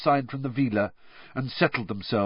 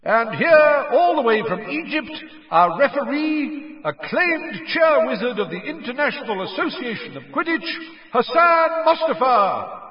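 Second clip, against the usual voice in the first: stadium announcer; 12 semitones higher, 4 dB louder than usual. A narrator's reading. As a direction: announce the team to the whole stadium. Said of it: "yelled Bagman".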